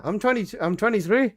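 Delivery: normal talking voice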